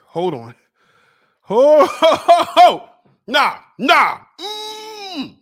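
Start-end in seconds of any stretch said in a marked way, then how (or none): 1.4-4.2 loud, high gasping ho ho from a burning mouth; spicy chip burn, loud hot gasps; pained from the heat. Real, not acted. 4.4-5.4 pained whimper. Real, not acted. Burning from spicy chip.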